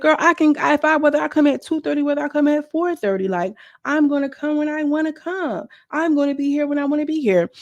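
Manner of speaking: high-pitched defiant quote, I come when I want; defiant high mock voice.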